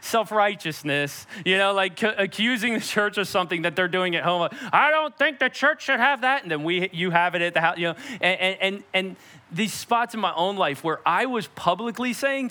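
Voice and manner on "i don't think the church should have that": mock prissy churchgoer. Mocking self-righteous churchgoer.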